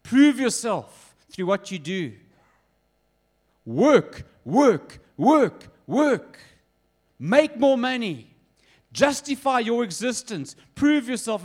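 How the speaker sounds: a half-hearted mock yell, building on the repeated work; world screaming at you. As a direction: hammer each work like a demanding boss. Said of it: "screaming to you".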